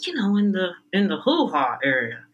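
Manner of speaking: usual speaking voice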